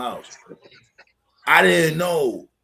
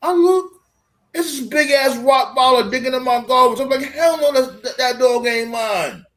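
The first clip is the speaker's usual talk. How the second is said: high-pitched, incredulous outburst; alarmed retelling; 9 semitones higher, 5 dB louder than usual. Retold or imitated. Telling friends of Rottweiler digging in his garbage, high and alarmed. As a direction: relive the shock in a raised, high voice.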